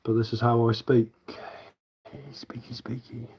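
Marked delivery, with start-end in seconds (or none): 2.3-3.3 very soft, whispered silly aside; playful whisper. Pretend. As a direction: whisper it playfully, barely audible. Playful aside about own speech.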